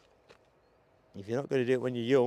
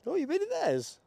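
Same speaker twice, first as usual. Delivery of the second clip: surprised. Real, not acted. Guest mentions famous stream.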